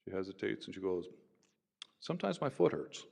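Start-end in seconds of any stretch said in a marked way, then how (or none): 2.0-3.0 small, high, hesitant answer; lightly voiced woman, pitched up; woman's hesitant reply. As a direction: voice her shy simple answer up high.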